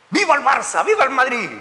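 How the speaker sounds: high-pitched pretend football chant; mock fan cheer. Preacher parodies football fans to the congregation, mock cheering. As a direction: cheer both teams like a fan.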